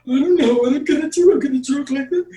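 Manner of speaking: very high, fast, silly voice; squeaky character voice. Shown as a demonstration. Improviser shows a character choice, very high and silly. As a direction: pitch way up into a cartoonish squeak. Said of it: "People that play characters make choices".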